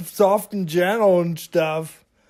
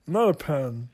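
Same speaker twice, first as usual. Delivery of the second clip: normal talking voice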